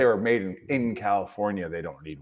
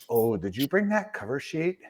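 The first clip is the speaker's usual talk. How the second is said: put-on voice of a DMV clerk, slightly raised; Brandon impression; 3 semitones higher, 5 dB quieter than usual. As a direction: mimic his way of talking with a rising question. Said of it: "it's just the way he talks".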